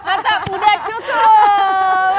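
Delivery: slow, loud laughing gasp; laughing strained. Real, not acted. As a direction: laugh it out, exhausted from holding. Straining end of plank. (tertawa sambil terengah)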